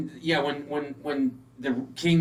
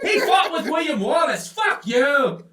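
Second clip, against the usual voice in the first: mock yell; 9 semitones higher, 5 dB louder than usual. Mock Scotsman yelling a grudge.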